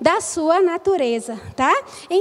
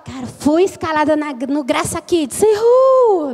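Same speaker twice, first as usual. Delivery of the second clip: happy and excited. Shown as a demonstration. Teacher demonstrating congruent joy at being rostered, happy and excited. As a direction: show you are thrilled, cheering at the end. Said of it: "eu mostrar que eu estou feliz".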